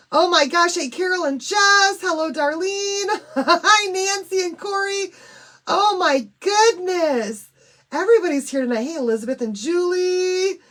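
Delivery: loud, high, bubbly hellos; excited live-stream hellos; thrilled greetings. Real, not acted. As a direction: greet friends like a party is starting.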